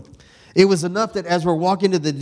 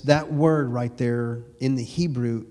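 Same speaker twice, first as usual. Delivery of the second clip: ordinary talk, nothing marked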